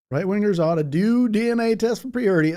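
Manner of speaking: loud, high mock-Southern voice; Southern drawl. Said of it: "start using that Southern, that Southern voice".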